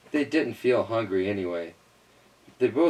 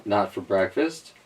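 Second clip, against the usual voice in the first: usual speaking voice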